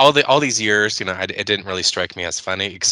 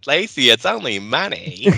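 through her teeth; 6 semitones higher than usual. Retold or imitated. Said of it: "talking through her teeth".

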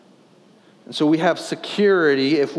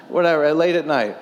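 usual speaking voice

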